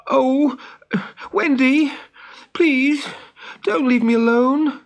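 high, loud, urgent plea; desperate boy pleading with a friend. A narrator's reading. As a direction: beg in broken-up bursts. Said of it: "pleaded Peter".

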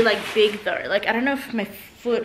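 ordinary talk

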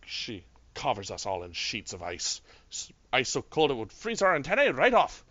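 put-on an intended Australian that slides into a Scottish accent; a hint of Scottish brogue, meant to be Australian. Game master voicing Apriel, an Australian accent that comes out Scottish. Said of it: "Apparently I'm going Scottish".

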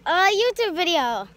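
loud, high excited shout. Real, not acted. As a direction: burst out excitedly. Excited exclamation.

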